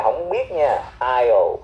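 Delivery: ordinary talk, nothing marked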